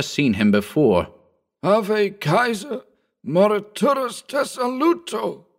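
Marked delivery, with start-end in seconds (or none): 1.6-5.5 very high, slow Latin salute; grand shout. A narrator's reading. Old Bugs saluting with his dying words, high slow. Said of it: "he shouted".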